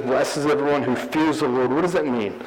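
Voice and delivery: ordinary delivery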